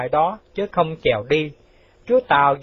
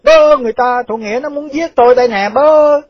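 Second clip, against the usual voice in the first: high, alarmed shouting; loud cry for help; 9 semitones higher, 8 dB louder than usual. Acted. Quan phủ fearing Thủ Nghĩa will kill him, shouting loudly. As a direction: holler for help in a panic. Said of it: "la lớn lên rằng".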